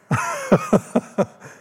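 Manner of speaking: high giggling cackle at own joke; high-pitched laugh. Real, not acted. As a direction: burst into high, amused laughter. Laughs at his own story.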